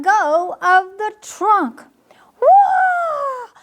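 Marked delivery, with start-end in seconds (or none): 2.4-3.5 high squealing cry of falling; startled squeal. Acted. Squirrel falling from tree, high squeal. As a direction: yelp as he slips and tumbles. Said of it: "squealed Pip as he tumbled".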